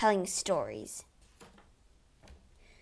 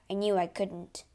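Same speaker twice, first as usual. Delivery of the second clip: ordinary delivery, nothing marked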